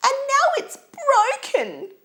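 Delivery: put-on very high, loud baby bear squeal; squeaky wail. A narrator's reading. Baby Bear mourns his broken chair, loud squeaky wail. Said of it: "he squeaked".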